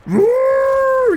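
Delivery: very high, loud squealed cheer; cheerleader scream. Retold or imitated. Gamer cheering a friend's penta, cheerleader scream. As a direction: let out a long piercing whoop. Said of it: "I go like cheerleader mode".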